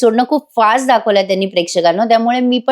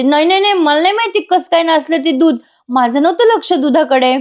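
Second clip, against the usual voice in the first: flustered denial; 8 semitones higher than usual. Retold or imitated. Priya denies spoiling milk.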